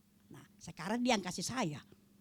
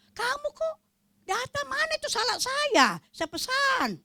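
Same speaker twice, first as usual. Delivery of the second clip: put-on high, louder old mother's voice; high-pitched scolding old lady; imitating old mother; 9 dB louder than usual. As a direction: play the forgetful old mum fussing. Old mother voiced on video call.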